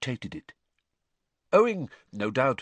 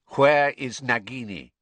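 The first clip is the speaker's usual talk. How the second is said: cold, louder villain demand; icy cold; 6 dB louder than usual. A narrator's reading. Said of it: "said the cold voice".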